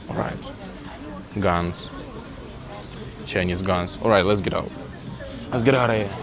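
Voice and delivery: normal talking voice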